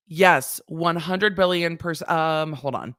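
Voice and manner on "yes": ordinary delivery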